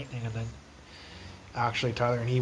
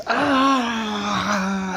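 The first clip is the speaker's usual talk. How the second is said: high, loud imitated yell; imitated scream; 8 semitones higher, 7 dB louder than usual. Man retelling a stranger yelling in the street, imitated high scream. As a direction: belt out a stranger's wild scream. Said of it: "He was like this".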